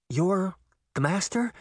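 incredulous, with a rising end; stunned disbelief. A narrator's reading. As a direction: ask it in shocked realisation. Stunned at discovering the traitor.

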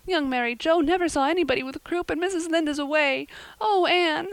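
put-on high, loud upset girl; rising into a despairing cry; upset girl pleading to her friend; distraught. A narrator's reading. Diana distraught.

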